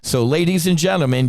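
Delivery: ordinary talk, nothing marked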